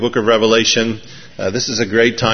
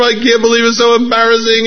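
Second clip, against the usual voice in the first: high, hurried mock embarrassment; mortified apology; 11 semitones higher, 3 dB louder, faster than usual. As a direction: gush like a flustered preacher, pitched up. Acted mortified preacher apologizing.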